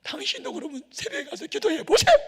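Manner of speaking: wife pleading through tears, mimicked high and loud; mock tearful wife. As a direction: squeal it out like his wife in tears. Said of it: "막 울면서".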